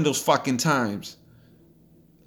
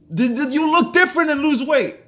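much higher, louder mock voice of a complainer; mocking whiny voice; 10 semitones higher, 4 dB louder than usual. Host mimicking people who won't fast, high mocking voice. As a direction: squeak it out like a whiny client.

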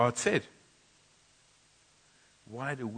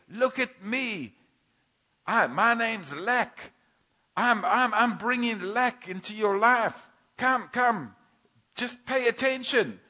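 high, slow, mocking voice of Lack; a sneering tempter calling for attention; taunting enemy; 11 semitones higher, 4 dB louder, slower than usual. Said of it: "the one shouting".

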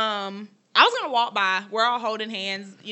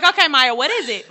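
eager; 4 semitones higher, 8 dB louder than usual. Retold or imitated. Retold eager question.

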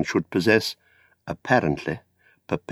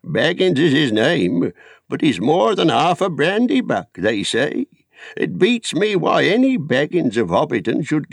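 pry like a nosy village elder; high quavering old busybody; old Noakes; 7 semitones higher than usual. Acted. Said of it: "asked old Noakes of Bywater".

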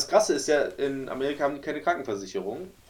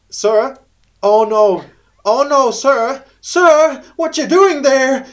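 much higher, louder, slower squeaky mock plea; high voice in English; 11 semitones higher, 12 dB louder, much slower than usual. Friend pranks him at the tree, high pleading English voice. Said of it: "so eine helle Stimme auf Englisch".

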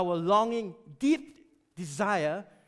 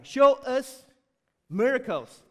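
much louder, insistent demand; demanding shout; 3 semitones higher, 7 dB louder, slower than usual. Acted. Crowd shouts at Jesus to show miracles, loud demand.

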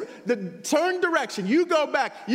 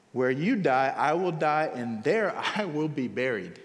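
solemn scripture reading; 12 semitones lower, 3 dB quieter than usual. A narrator's reading. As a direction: read it like a sacred vow. Reads Ruth's vow.

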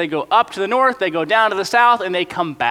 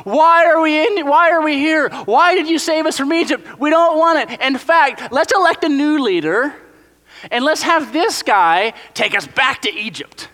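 much higher, louder complaining wail; whining Israelites; 10 semitones higher, 4 dB louder than usual. Pretend. The panicked nation demanding to go back to Egypt, high and loud whining. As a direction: whine and protest in panic. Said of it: "they're freaking out".

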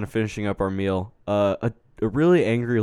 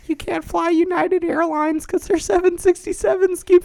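very high cartoon phone voice. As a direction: keep the squeaky cartoon act going. Continues cartoon voice.

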